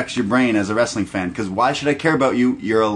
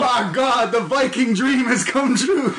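commentator call; 13 semitones higher than usual. Retold or imitated. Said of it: "imagine J R calling".

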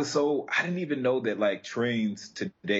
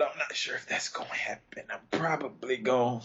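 hushed, half-secret aside; whispering; 3 semitones higher, 4 dB quieter than usual. Real, not acted. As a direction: drop to a whisper. Unsure about the train trip.

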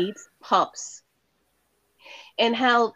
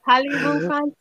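put-on French accent; 3 semitones higher, much faster than usual. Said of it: "that's much better".

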